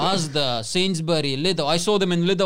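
ordinary talk

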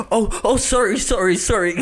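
high, laughing imitation of a flustered apology; mock flustered apology. Host imitates his friend barging in, high mock apology. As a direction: mimic someone backing out of a room embarrassed. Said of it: "Entraste al cuarto y dijiste".

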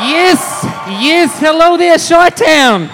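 loud, fired-up stage yell; crowd hype shout. Real, not acted. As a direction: pump up the crowd. Hyping a live crowd.

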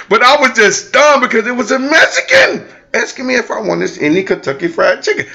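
laughing as he explains; laughing while talking. Real, not acted. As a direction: keep laughing through it.

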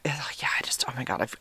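sighing, soft whisper to herself; hushed self-reproach. Real, not acted. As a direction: breathe it out in regret. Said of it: "whispered regret".

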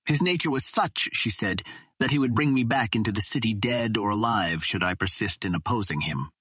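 plain reading voice

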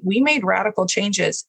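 normal talking voice

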